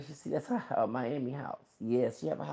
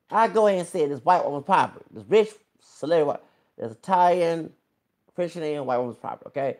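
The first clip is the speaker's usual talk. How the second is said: louder, fast, higher rant; quick, loud and higher scolding; heated rant; 5 semitones higher, 8 dB louder, faster than usual. Real, not acted.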